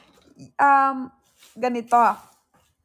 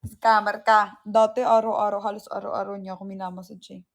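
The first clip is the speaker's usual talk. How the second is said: normal talking voice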